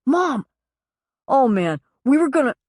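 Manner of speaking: high, loud flustered burst; flustered outburst. Acted. Son caught by mother in messy house, flustered. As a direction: scramble to explain, caught out.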